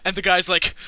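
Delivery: normal talking voice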